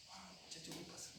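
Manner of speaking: soft, sleepy surprised mumble; a hint of a drowsy master; groggy waking voice.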